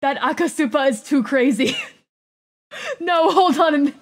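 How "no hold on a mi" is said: loud laughing protest; half laugh, half yelp; laughing cry. Real, not acted. Laughing panic in game.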